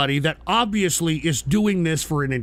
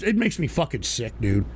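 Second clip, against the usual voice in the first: ordinary delivery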